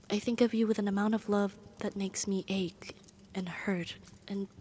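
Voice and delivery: soft, halting, aching confession; hushed love confession. Acted. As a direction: confess it almost in a whisper.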